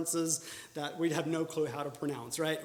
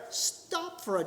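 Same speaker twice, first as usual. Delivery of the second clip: usual speaking voice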